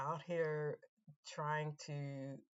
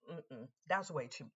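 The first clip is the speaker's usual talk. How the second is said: usual speaking voice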